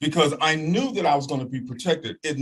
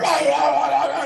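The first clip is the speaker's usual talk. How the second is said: mock dog barking; 12 semitones higher, 4 dB louder than usual. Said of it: "And they're like".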